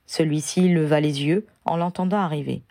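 ordinary delivery, nothing marked

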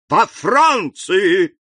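a high, loud, slow shout. A narrator's reading. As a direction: cry it out in alarm. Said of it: "закричал он". (король кричит в ужасе)

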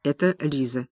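usual speaking voice

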